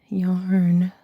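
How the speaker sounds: breathy whispered yarn. Pretend. Showing off her yarn stash to viewers, whispered. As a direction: drop to a secret whisper on yarn. Playful run of repeated yarn.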